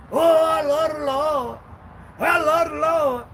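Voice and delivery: hammed-up holy voice; mock pious churchgoer. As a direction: drag it out high like a phony saint. Mocking churchgoers' claim.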